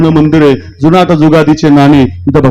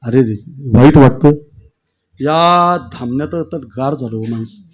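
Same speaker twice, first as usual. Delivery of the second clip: ordinary delivery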